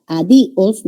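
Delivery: adiós wrongly broken as a-DI-os; separated vowels. Shown as a demonstration. Teacher showing students a word that does not exist, a-DI-os. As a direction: stress the i of adiós and detach os. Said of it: "no existe".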